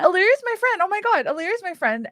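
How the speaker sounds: high, loud and delighted; excited gushing. Acted. Elf telling the boy about Illyria, high and gushing. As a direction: gush proudly about a friend.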